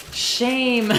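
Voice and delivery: drawn-out, high cry of condemnation; Game of Thrones nun. Acted. Streamer mimicking the shame nun for chat, high drawn-out chant. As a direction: call it out high and loud, long vowel. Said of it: "The nun from Game of Thrones".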